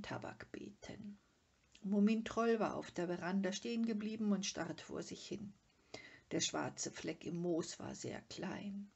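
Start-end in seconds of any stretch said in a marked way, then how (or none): none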